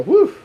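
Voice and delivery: sudden high, loud "whew"; a short whoop leaping up in pitch; startled whew. Retold or imitated. Jesse on the phone as the baby kicks, startled high whew.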